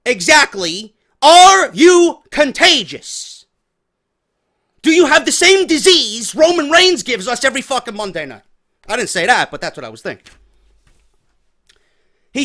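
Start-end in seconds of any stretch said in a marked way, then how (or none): 1.2-8.4 loud demand. Retold or imitated. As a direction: blast it at the sick coworker. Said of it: "the next words out of my mouth".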